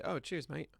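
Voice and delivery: soft, throwaway imitation British accent; lightly imitated British "cheers, mate"; mock British accent. Shown as a demonstration. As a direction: put on a token British accent, quietly. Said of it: "use a crappy imitation accent".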